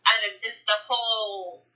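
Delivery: usual speaking voice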